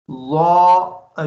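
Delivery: ज़ा said slowly and drawn out, loud; ज़ा stretched long with a strong ज़ sound; model pronunciation. Shown as a demonstration. Teacher modelling ज़ा for a child student, slow and loud. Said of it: "ये अब ज़ा पढ़ते हैं".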